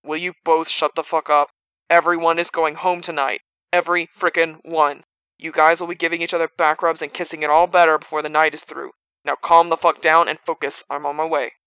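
gruff voice crackling over a walkie-talkie; barking orders through a radio. A narrator's reading. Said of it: "the sound of Bob's voice through it".

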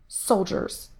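exasperated sigh on the word. A narrator's reading. Diana despairs of secretive soldiers, sighed. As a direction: let it out as a weary sigh. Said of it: "she sighed".

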